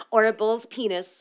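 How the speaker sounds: ordinary talk, nothing marked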